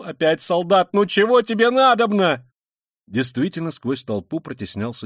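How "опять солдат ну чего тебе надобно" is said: loud, climbing irritated cry; angry challenge. A narrator's reading. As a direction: round on him sharply, getting higher. Mother confronts approaching policeman. (громко, раздражённо накинуться)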